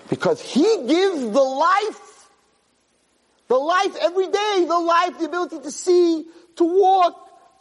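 preaching at full force. Acted. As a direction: build the sermon to a fervent peak.

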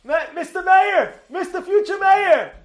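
high, loud, drawn-out call to the mayor; protest crowd. Retold or imitated. Protesters hailing the future mayor, calling out high and loud. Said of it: "they're saying to him".

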